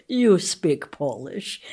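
put-on slow, careful Polish-accented English. Grateful parents tell the announcer, in a slow Polish accent. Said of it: "saying with the Polish accent".

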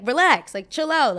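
urgent calming. Retold or imitated. Rev calming angry girls.

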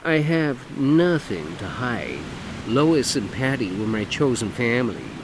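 camp it up, lilting and showy; flamboyant man. Acted. Dougie voiced in story.

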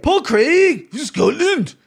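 put-on high, loud Scottish accent on the name. Podcaster hyping a Scottish fighter, in a Scottish accent. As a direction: announce him like a Scot. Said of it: "that's a pretty fucking good Scottish accent".

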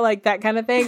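usual speaking voice

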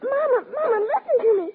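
high, desperate pleading, crying; tearful pleading. Acted. Girl begging her busy mother to dig the woman out, tearful. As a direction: beg her to listen, voice breaking.

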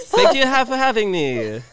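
high, loud, drawn-out joke voice; hammed-up thank-you, stretched out; mock sign-off. Ending a fake show for friends, loud mock sign-off.

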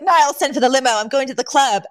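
a touch of flustered refusal; Mister Sheffield. Retold or imitated. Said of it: "jumps up from his chair".

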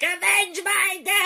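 high, slow ghostly wail; sea witch voice. Retold or imitated. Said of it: "just the goddamn sea witch".

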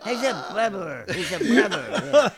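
laughing tease. Real, not acted. Teasing, laughing echo.

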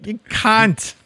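put-on high-pitched Australian accent; Jim Jeffries. Cohost quoting an Australian comedian, high Australian accent.

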